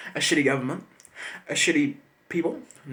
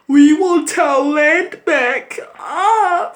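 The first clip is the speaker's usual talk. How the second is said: hammed-up fake crying, much higher and louder, very slow; mock wailing; 11 semitones higher, 10 dB louder, much slower than usual. Mocking protesters on the news, hammed-up wailing cry. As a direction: wail it like a theatrical sob. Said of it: "on the news".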